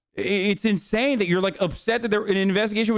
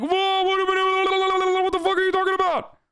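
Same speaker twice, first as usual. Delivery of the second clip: mocking rioter; 9 semitones higher than usual. Streamer mocks Capitol rioters with a high, whiny voice. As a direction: whine like a self-righteous Capitol rioter. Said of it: "And then they're like".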